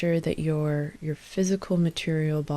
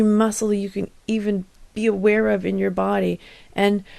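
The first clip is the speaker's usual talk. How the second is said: louder, building tension through the hold; insistent push through a tensing exercise; urgent coaching; 3 semitones higher, 7 dB louder than usual. Real, not acted. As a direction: urge them to squeeze and keep holding. Urging listeners through muscle tensing.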